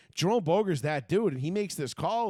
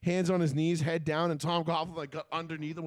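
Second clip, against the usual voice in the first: normal talking voice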